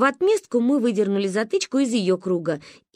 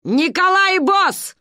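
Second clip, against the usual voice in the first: slow, loud, high boom; thundered; 10 semitones higher, 5 dB louder, slower than usual. A narrator's reading. Grandma thunders after the fleeing woman, loud. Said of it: "прогрохотала ей вслед Ба".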